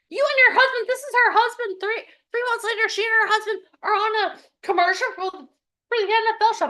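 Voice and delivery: high fast excited telling. Real, not acted. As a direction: gush with pride, speeding up. Excited retelling her success.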